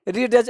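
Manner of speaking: "रीडज" with a separate d before j; d sounded. Shown as a demonstration. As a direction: say "रीडज" as spelt, d then j. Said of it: "ऐसे नहीं पढ़ना है".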